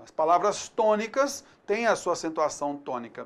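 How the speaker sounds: usual speaking voice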